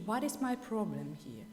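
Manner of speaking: ordinary talk, nothing marked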